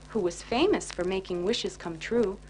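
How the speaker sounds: plain reading voice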